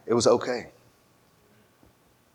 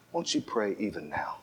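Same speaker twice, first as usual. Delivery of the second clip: lower, much softer pleading preacher; hushed preacher altar call; 7 semitones lower, 15 dB quieter than usual. Retold or imitated. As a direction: draw them in with a hushed appeal. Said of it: "he will stand right at the edge and he will talk and he will say".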